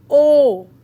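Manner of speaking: O shouted out full and loud, open and dark; heavy O. Shown as a demonstration. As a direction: belt a big round O. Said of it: "pronounce orange".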